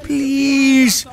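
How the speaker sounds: desperate begging shout. Acted. Begging for chicken.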